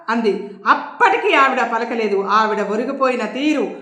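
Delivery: ordinary delivery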